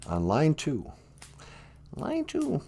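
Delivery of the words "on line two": much lower put-on voice; deep sidekick voice; deep voice announcing. Deep voice then host repeats.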